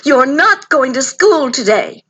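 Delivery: firm story-time ruling; mother's order. A narrator's reading. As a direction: lay down the law mildly, as a mother. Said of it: "her mother ordered".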